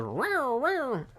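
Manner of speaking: high siren whoop; imitating a siren. Drunk storyteller imitating a police car horn, high siren whoop. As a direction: mimic the cop's horn chirp. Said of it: "He goes".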